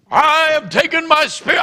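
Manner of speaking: high, loud wailed proclamation; mock prophetic shout. Ejected woman prophesying at the church, mock shouting.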